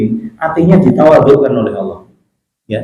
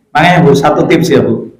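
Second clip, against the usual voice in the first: ordinary talk, nothing marked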